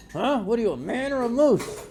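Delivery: usual speaking voice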